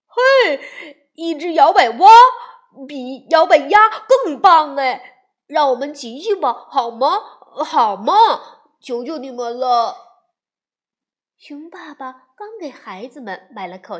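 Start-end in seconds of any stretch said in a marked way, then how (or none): 0.1-10.3 loud, high cub voice pleading; excited begging. Acted. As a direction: shout with glee, then plead to ride it. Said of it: "小熊哥哥也叫了起来". (小熊哥哥求爸妈让他骑摇摆蛙，大声兴奋地央求)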